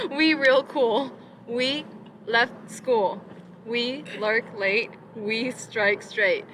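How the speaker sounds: slow, spaced-out poem reading in short lines; poem recitation. Acted. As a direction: recite it slowly, each short line on its own.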